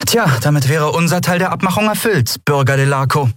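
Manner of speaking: höhere Stimme, betont geschäftsmäßig; höher und lauter, die Lieferung vorzeigen; Kapitänin Andriel, forsch. A narrator's reading. Andriel spricht zum Kunden. (Kapitänin präsentiert dem Kunden die Ware, höhere Stimme)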